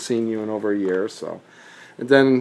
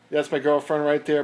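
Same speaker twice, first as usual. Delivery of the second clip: ordinary talk, nothing marked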